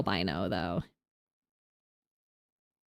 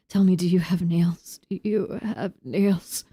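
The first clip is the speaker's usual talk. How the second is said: creepy whisper; eerie hushed voice of a stranger; freaky voice; slower than usual. Acted. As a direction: whisper it slowly through the door. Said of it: "I like doing freaky voices".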